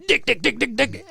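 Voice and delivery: imitating a child repeating a naughty word fast; rapid-fire repetition. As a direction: chant it quickly and gleefully like a toddler. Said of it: "at a super fast, like, cadence".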